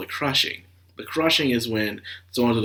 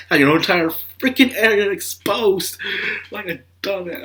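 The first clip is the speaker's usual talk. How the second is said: higher, mocking trainer voice; mock coach insult; 9 semitones higher than usual. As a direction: sneer at the sloppy fighter. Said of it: "They're just going up".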